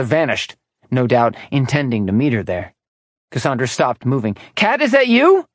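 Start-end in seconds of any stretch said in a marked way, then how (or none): 4.6-5.5 high, anxious question through a door; hopeful but scared call; nervous calling. Acted. Frightened woman calling out.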